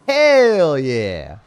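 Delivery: HELL held long, high and loud; bellowed cheer. Acted. Streamer repeats his catchphrase, bellowing it. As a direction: belt out "hell" and slide down on "yeah".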